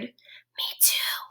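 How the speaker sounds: soft unvoiced whisper. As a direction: whisper so as not to bother rabbit. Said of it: "whispered Mouse".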